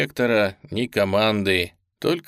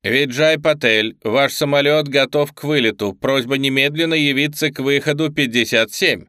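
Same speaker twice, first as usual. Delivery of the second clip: loudspeaker announcement; 5 semitones higher, 5 dB louder than usual. A narrator's reading. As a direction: announce it over airport speakers. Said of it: "Его прервал голос из громкоговорителя".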